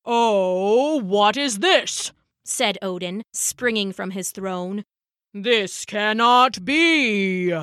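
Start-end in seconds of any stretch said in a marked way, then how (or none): 0.0-2.1 put-on slow, drawn-out old-god voice; startled Odin. Odin springing from his throne, slow startled boom. 5.3-7.5 very slow, grand god voice; aghast Odin. Acted. Odin springing from his throne, slow aghast voice.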